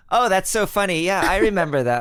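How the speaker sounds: fake phone chat. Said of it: "pretend conversation".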